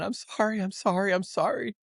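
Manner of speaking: high-pitched, pleading re-enacted cry. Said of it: "crying out saying".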